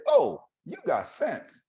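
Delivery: high, drawn-out motherly surprise; mock-surprised mother. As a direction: act amazed, pitch way up and slow. Mother surprised he talks normally.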